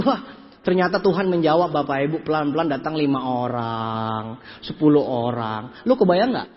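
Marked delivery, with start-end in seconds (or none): 2.2-5.8 low, stretched-out words; slow drawn-out. Acted. Speaker recounting people trickling in, slow and drawn-out. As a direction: drag out each phrase, low and long.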